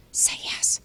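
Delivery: hushed urgent whisper in his ear. Acted. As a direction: unseen mentor prompting him in secret, a whisper.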